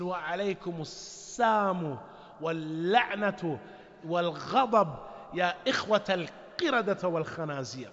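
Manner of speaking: acted-out angry comeback; indignant retort. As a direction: hurl it back as a forceful curse. Acting out Aisha's angry retort to insult.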